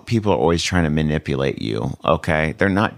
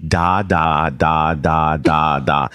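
put-on baby babble; 4 semitones lower, much slower than usual. Retelling his first tongues to listeners, light baby babble. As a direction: babble out syllables like a baby's first words. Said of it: "Literally like a baby".

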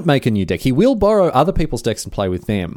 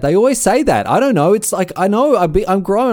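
put-on higher-pitched complaining child; whiny mock-kid protest, pitched up; exasperated kid; 8 semitones higher, 4 dB louder than usual. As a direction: imitate a kid baffled by adults. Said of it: "and they're like".

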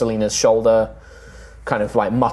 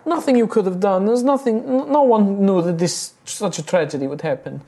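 high, gentle halfling voice reassuring; high consoling woman; 10 semitones higher than usual. Acted. Grieving halfling comforting the guilty knight, high and gentle. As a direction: soothe them, pitched way up.

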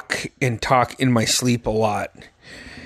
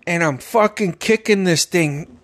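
normal talking voice

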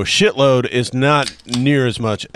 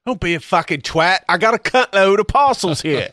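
higher-pitched mock British voice; hammed-up British accent; 6 semitones higher than usual. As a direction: say it as a cheeky Brit, pitched high. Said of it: "gotta do it in a British accent".

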